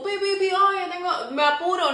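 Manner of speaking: a hint of a hurried driver honking in traffic; imitated honking driver. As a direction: lightly play a pushy driver leaning on the horn.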